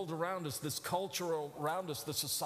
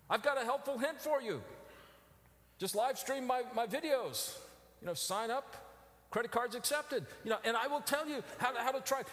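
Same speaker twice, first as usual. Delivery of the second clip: mock huckster; 8 semitones higher than usual. Mocking a self-help huckster.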